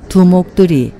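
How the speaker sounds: normal talking voice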